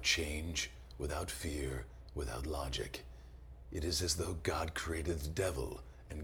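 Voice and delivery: deep, slow, soft trailer narrator; movie trailer voice. Retold or imitated. Teen reciting the Jaws trailer, deep slow ominous narrator. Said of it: "the voice".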